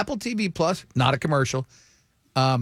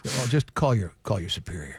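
very calm; 6 semitones lower than usual. Acted. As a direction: calmly brush off the local cop. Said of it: "they're always very calm".

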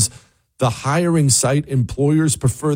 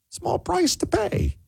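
very high, soft, drawn-out mockery; mocking echo; 6 dB quieter than usual. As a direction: sing it out slowly in a tiny sarcastic voice. Mocking a played clip's phrase.

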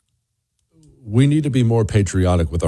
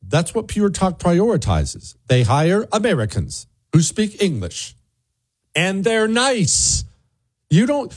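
higher, bouncy sales pitch; lightly pitched-up radio ad voice; upbeat ad read; 11 semitones higher than usual. Acted. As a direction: sell it cheerfully to listeners. Host's sponsor read.

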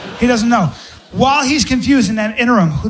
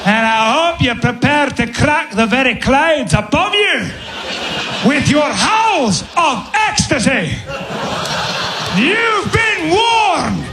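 high, slow, rousing Scottish cry; Angus; 7 semitones higher, slower than usual. Acted. Angus warning the lass, soaring Scottish proclamation. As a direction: build to a drawn-out Scottish battle cry. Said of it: "with that weird accent".

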